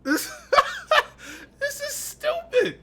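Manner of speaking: high, slow words through laughter; disbelieving laugh. Real, not acted. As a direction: laugh it out in frustration. Laughing at the ties.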